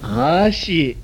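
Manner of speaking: usual speaking voice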